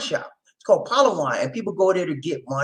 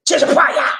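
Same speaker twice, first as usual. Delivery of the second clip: playful call-out; 11 semitones higher, 4 dB louder than usual. Acted. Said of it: "but we'll say".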